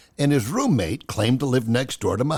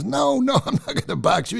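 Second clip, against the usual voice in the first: nervous laughing refusal; 11 semitones higher than usual. Retold or imitated. Gary refuses to box Ali.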